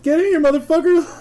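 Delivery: loud, high holler; urgent calling out. Retold or imitated. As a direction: yell to drag a friend inside. Retold call into the house.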